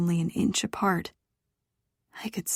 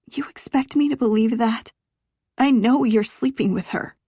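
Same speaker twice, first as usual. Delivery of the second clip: louder, higher jealous accusation; accusing; 5 semitones higher, 5 dB louder than usual. Acted. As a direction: throw the accusation at him. She accuses him of cheating.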